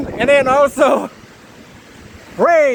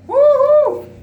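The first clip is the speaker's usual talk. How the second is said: excited whoop; 13 semitones higher than usual. Real, not acted. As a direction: shout out pure excitement. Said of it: "Excited ako!".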